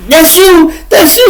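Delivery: high, loud squealing question; excited onlookers. Retold or imitated. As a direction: crowd shrieking at the winner. Onlookers ask if he won.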